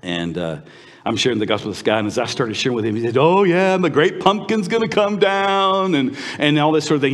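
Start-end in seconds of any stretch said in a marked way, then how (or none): 3.2-5.9 loud, high jeering voice; mock him with drawn-out words; exaggerated sing-out ridicule; imitated mocking. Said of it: "He just started mocking and making fun of me".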